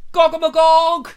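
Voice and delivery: ritual shout. Pretend. Friends standing up in the pub, loud ritual cry. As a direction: leap up and cry it out. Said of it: "if everybody else was to go".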